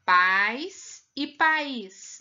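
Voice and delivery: very slow pais one syllable, país stress on ís; contrasting stress. Shown as a demonstration. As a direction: split país slowly. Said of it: "Tem diferença ou não tem?".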